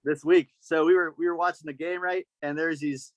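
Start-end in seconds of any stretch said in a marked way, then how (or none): none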